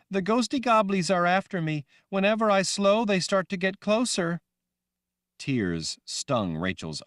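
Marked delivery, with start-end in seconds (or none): 0.1-4.4 put-on high, loud young girl voice; childlike Rachel. Girl Rachel asking the woman, high and loud. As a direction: sound like a scared little girl.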